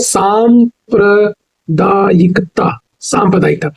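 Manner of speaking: very slow, सांप्रदायिकता syllable by syllable; slow dictation. Shown as a demonstration. Teacher dictating सांप्रदायिकता slowly. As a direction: stretch सांप्रदायिकता as saam-pra-daa-yi-ka-taa. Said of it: "सांप्रदायिकता नहीं होता".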